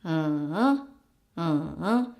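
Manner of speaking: hummed dip from low down to the bottom, then up; low dipping hum that climbs at the end; full third tone. Shown as a demonstration. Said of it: "Third tone's natural sound is two one four".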